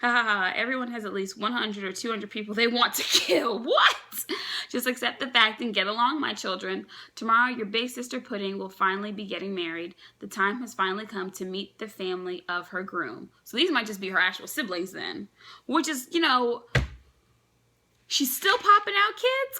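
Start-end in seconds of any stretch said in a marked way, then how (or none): 3.6-4.0 sudden squeaked high what, much higher and louder; shocked what. Real, not acted. Reader blurts out in disbelief at a panel, shocked squeak. 18.1-19.6 "popping" stretched high and loud, slow and squeaky; incredulous. Real, not acted. Reader marvels at Big Mom's many kids, squealed disbelief.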